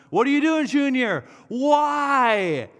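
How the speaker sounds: high, loud exasperated demand; exasperated God. Retold or imitated. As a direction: play God shaking his head in disbelief. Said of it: "shaking his head at me going".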